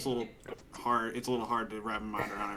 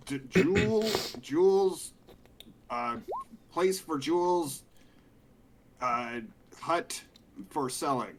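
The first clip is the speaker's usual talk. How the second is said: broken Dwarvish; 6 semitones higher, slower than usual. Acted. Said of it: "you're saying that in Dwarven?".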